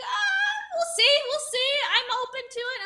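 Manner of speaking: high teasing impression of a hopeful friend; imitating friend. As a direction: squeak it out like a cheerful know-it-all. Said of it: "And he's like".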